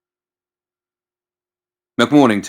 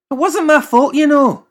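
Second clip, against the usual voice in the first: much higher, louder defensive whine; whiny self-defence; 7 dB louder than usual. Acted. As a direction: plead innocence from way up high. McMorning defending himself.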